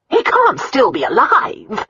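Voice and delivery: high, drawn-out disbelieving cry; shocked exclamation. A narrator's reading. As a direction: exclaim it slowly, pitched high. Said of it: "the Countess exclaimed".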